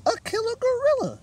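high, loud panicked squeak; frightened squeak. Acted. Mouse telling Panda about the killer gorilla, squeaking in fear. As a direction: squeal it in terror. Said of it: "she squeaked".

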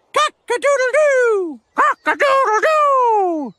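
loud, high, long-held crowing; rooster crow. Acted. Mocked as a rooster, crowing loudly. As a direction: crow like a rooster, stretching the last note.

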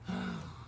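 quiet voiceless frightened gasp. Shown as a demonstration. Acting afraid for the child, scared gasp. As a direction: suck in air in fright.

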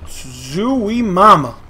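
loud, stretched-out whoa; excited exclamation. Real, not acted. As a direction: draw it out, amazed and loud. Reacting to big score.

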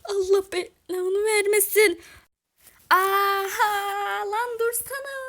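very loud, high, drawn-out yelling; scuffle shouting. Acted. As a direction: yell out as if under attack. Shouting during an acted scuffle.

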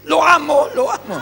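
loud, high mock cry of adoration; imitated fan's shouting. Woman gushing over the doctor before her husband, imitated shout. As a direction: shout it like a star-struck admirer. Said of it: "Gritó".

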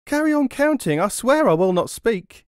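very high, loud frantic promise; terrified plea. A narrator's reading. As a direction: plead for his life. Terrified robber begging.

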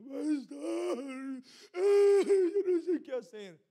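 mock sobbing, high and loud; crocodile tears. Church member begging the pastor, hammed-up sobbing. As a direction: ham up a whiny crying plea. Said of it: "Con lágrimas como cocodrilo".

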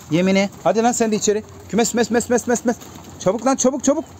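high, excited hurrying call; shooing it inside. Real, not acted. Keeper hurrying the last pigeon into the coop, high excited calling.

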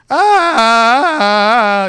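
loud, high wailed "ah"; long awful sung note, belted high; unbearable singing. Acted. Mocking people who can't sing, unbearable loud wail. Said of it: "the people where they're like".

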